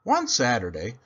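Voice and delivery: ordinary delivery, nothing marked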